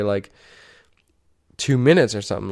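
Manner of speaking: ordinary talk, nothing marked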